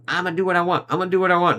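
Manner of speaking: a hint of a stubborn anime girl insisting; tsundere. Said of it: "goes on the more kind of tsundere kind of route".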